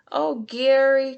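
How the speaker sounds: high, loud heartfelt cry; cried. A narrator's reading. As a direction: cry out in dismay. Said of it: "cried SpongeBob".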